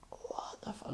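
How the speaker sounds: barely audible, voiceless disbelief; stunned whisper. Retold or imitated. Man looking up at the talkers, stunned whisper. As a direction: whisper it under your breath, baffled. Acting out stunned private reaction.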